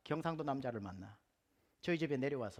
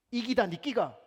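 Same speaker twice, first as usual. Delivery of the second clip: loud, high Gyeongsang woman's retort; Gyeongsang dialect; 6 semitones higher, 9 dB louder than usual. Retold or imitated. Gyeongsang woman in the subway answering a Seoul woman, loud Gyeongsang dialect. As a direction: snap back in broad Gyeongsang dialect. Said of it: "경상도 여자".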